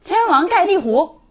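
opera password line. Pretend. Quoting the bandits' famous password.

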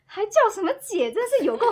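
loud, high laughter through the words; can't stop laughing, loud and high. Real, not acted. Laughing over boyfriend calling her sister. (大声高音笑着说)

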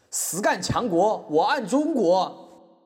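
slow, high, drawn-out proclamation; patriotic slogan. Acted. Defendant ends his plea with a slow patriotic slogan. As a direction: declaim it like a banner slogan.

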